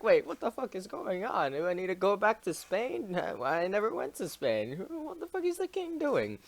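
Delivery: high mock panicked loyalist; confused conservatives. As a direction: fret like a baffled royalist.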